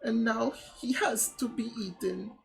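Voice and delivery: high, mock-tearful French accent; lamenting French villagers. Villagers mourning eaten vegetables, high mock-tragic French accent. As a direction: wail in a hammed-up French accent.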